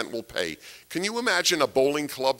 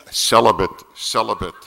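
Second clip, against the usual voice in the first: urgent stage whisper; 4 semitones lower, 7 dB louder than usual. Retold or imitated. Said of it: "And I kept kicking them".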